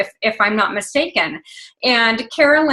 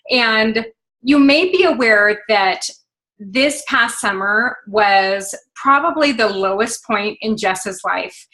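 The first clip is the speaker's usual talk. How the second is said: ordinary talk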